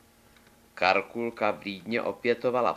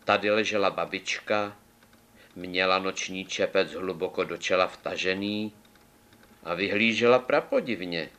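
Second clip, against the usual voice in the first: ordinary delivery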